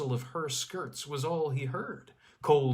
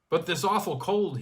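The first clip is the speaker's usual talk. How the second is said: louder, higher sharp cry; 6 semitones higher, 5 dB louder than usual. Acted. Skier cries out to the girl at the icy cold, sharp. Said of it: "he cried out sharply".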